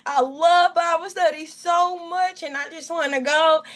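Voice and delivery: replaying the tearful begging to God; retold crying plea. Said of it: "I'm crying and I'm weeping".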